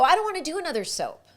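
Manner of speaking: high, quick retold refusal; reluctant protest. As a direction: push back like a reluctant actor.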